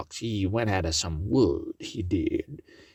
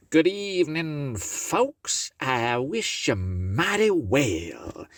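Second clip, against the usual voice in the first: raised pitch for the fox; sweet-talking the folks as the fox; high sly fox voice; 6 semitones higher, slower than usual. Acted. Brer Fox greeting the folks from the door, high sly voice.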